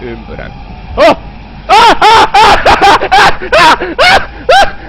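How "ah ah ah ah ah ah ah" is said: high, loud repeated shrieks; terrified screams. Real, not acted. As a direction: shriek in panic at each scare. Scared screams in game.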